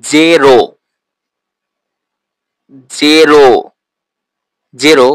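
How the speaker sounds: জেরো said slowly and loudly, falling; model pronunciation. Shown as a demonstration. Teacher modelling জেরো for students to repeat. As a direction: clear drilled জেরো for learners. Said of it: "প্রথমে যেটি আছে".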